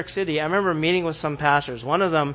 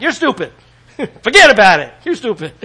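louder, higher mock New York bluntness, laughing; blunt New Yorker; 5 semitones higher, 10 dB louder than usual. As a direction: blurt it out like a rude New Yorker. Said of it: "Out here, like".

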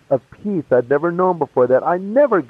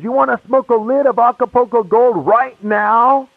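higher-pitched, eager offer; eager buddy; 7 semitones higher than usual. Retold or imitated. Old buddy offers drugs in a dry time, eagerly.

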